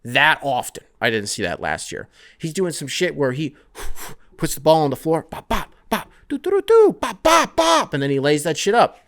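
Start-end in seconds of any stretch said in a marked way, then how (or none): 2.4-7.9 bouncy high bop-bop dribble noises, rising higher; scatting dribble sounds. Retold or imitated. Podcaster miming Ausar's dribble, high scatted bops. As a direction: scat the dribble like a beat.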